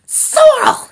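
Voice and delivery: high, loud angry cry of a name; furious little rat, high and loud; angry hiss. A narrator's reading. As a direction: spit the name out at the top of your range. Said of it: "she hissed".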